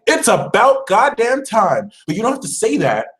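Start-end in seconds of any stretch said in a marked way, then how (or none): 0.1-1.9 high, slow and emphatic, about damn time; slow high mock exasperation; fed-up finally attitude. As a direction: drag it out like you've waited forever. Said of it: "your attitude has to be like".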